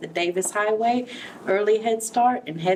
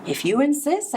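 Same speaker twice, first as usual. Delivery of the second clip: sweetly; 8 semitones higher than usual. A narrator's reading. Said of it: "answered the friendly ladybug sweetly".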